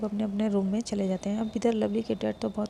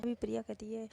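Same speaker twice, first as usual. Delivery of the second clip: plain reading voice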